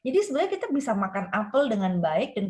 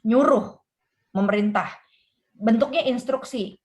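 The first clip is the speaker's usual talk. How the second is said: ordinary talk, nothing marked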